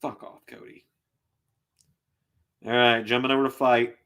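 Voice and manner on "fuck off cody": whispered, barely audible curse; bitter whisper. Real, not acted. Streamer cursing the wrestler to himself, bitter whisper. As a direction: hiss it under your breath.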